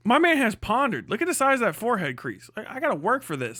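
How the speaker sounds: usual speaking voice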